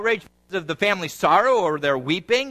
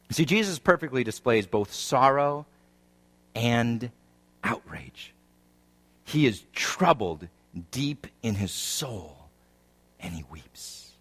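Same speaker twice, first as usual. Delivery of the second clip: solemn preaching; 9 semitones lower, 4 dB quieter than usual. Real, not acted. Preacher tells the congregation of Jesus' sorrow and outrage, solemn hush. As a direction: let it drop away softly, phrase by phrase.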